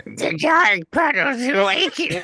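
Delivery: high, slow, strained demon growl; like an old guy dying; ink demon voice. Shown as a demonstration. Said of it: "that sounded like an old guy dying".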